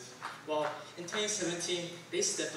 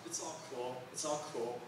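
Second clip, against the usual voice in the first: much softer, lower easygoing voice; laid-back mom; 3 semitones lower, 8 dB quieter than usual. Retold or imitated. Mom brushing off a stranger who bumped her, soft and easygoing. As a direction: shrug it off mildly. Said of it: "my mom would just".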